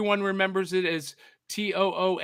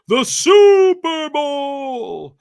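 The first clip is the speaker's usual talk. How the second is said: much higher, louder, very drawn-out "the Super Bowl"; announcer hype; 10 semitones higher, 12 dB louder, much slower than usual. Acted. Host hyping the guest's Super Bowl coverage like an announcer.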